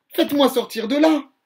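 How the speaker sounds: loud, high panicked cry; a trapped puppet calling out in fear; crying for help. Acted. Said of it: "pleure Pinocchio".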